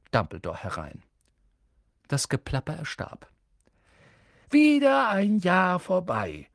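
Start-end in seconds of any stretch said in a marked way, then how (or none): 4.5-6.5 put-on high, loud, slow jolly old wizard; exuberant call. Dumbledore greeting the feast, high, loud and merry. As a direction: cheerfully boom it over the hall. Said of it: "rief Dumbledore ausgelassen".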